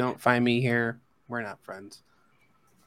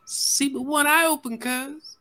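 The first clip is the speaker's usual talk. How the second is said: squeaky high voice. Acted. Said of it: "I wanna say".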